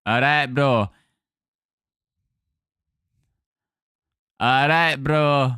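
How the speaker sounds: broad Mancunian; Manchester accent. Shown as a demonstration. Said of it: "try and do your accent".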